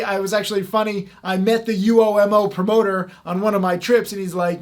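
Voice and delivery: normal talking voice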